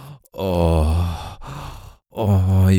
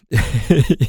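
short amused laugh; 6 semitones higher than usual. Acted. Laughs before dominant reply.

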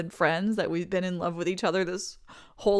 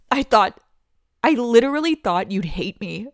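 loud, overwhelmed relief; emotional outburst; 7 dB louder than usual. Acted. Friend admitting her fears, loud emotional relief. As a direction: let it spill out loudly.

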